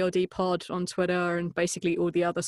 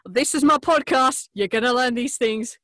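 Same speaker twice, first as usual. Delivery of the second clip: higher, louder cheesy promo voice; hammed-up podcast promo; mock ad read; 6 semitones higher, 7 dB louder than usual. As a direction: pitch it like a radio spot. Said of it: "you could literally do sixty seconds of".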